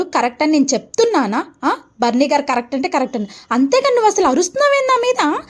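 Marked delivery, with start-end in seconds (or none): 3.5-5.4 high, fast angry retort; angry scolding. Acted. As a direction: snap back at the child. Mother scolding shouting daughter.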